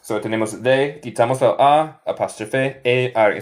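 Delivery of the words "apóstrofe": usual speaking voice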